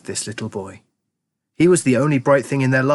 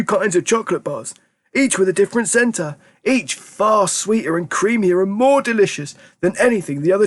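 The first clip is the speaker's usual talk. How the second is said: put-on fond old grandpa; 8 semitones higher than usual. A narrator's reading. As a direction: savour the words like an old grandpa. Grandpa Joe voiced.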